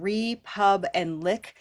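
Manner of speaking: "re, pub, and lick" slowly, each part apart; syllable by syllable. Shown as a demonstration. Teacher showing Janine three syllables, slow separated "re, pub, and lick". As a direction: break "republic" into separate beats: "re", "pub", "lick". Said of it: "we do have three syllables".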